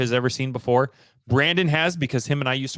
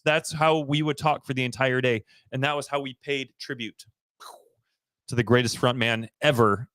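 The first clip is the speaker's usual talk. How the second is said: ordinary talk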